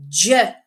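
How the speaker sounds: J as a short "juh" sound; J voiced as "juh", not its letter name; first sound only. Shown as a demonstration. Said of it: "What's the first sound you can hear".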